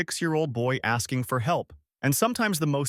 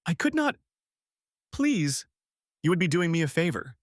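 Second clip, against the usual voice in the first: tearful; 5 semitones higher than usual. Acted. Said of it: "her eyes filling with tears".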